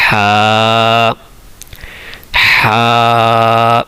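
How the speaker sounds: ہا breathed from the middle of the throat, a held. Shown as a demonstration. Teacher shows students where ہا comes from, throaty. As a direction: push ہا from mid-throat, then hold the a. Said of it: "یہ بالکل آپ کے throat کے بیچ میں سے".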